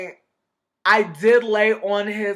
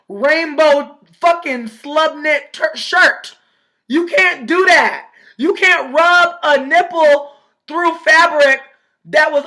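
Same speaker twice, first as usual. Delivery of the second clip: outraged rant; 7 semitones higher, 6 dB louder, slower than usual. Acted.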